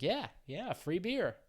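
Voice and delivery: high, eager mock reassurance; imitating the tour staff. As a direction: chirp it up high like a salesman. Guides promising free beer.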